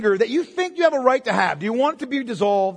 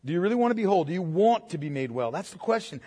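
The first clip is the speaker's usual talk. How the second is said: ordinary delivery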